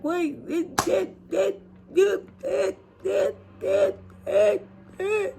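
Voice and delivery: high stuttering it it it; stammering. Retold or imitated. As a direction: flounder for words. Stammering for excuses.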